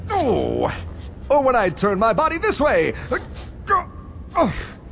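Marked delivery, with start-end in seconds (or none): 0.1-0.7 strained cry in a wrestling hold; pained grunt. Acted. 4.3-4.8 strained cry in a wrestling hold; pained grunt. Acted.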